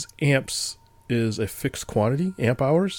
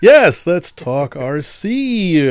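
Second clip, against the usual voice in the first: overeager; 6 semitones higher, 8 dB louder, much slower than usual. Pretend. Mock eager answer.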